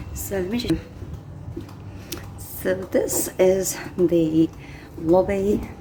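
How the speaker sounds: hushed voice in a public place; hotel lobby. Real, not acted. Walking through quiet hotel lobby.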